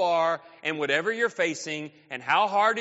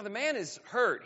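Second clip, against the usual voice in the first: ordinary talk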